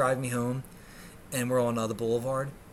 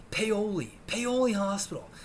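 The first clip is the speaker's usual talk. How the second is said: normal talking voice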